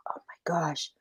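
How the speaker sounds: sharp unvoiced whisper; coyote whispering. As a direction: hiss it under your breath as the hungry coyote. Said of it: "He's like".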